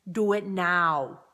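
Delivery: slow, loud, clipped action-hero command; Arnold Schwarzenegger. Retold or imitated. Coach urging viewers to recontact people, in a slow, loud tough-guy voice. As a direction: bark "do it now" slowly and loudly like a movie tough guy. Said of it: "In my best Arnold Schwarzenegger voice".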